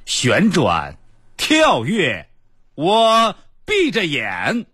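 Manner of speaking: slow, high, theatrical recital; dramatic recitation. Retold or imitated. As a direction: recite like a stage poet. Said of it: "搁那儿朗诵".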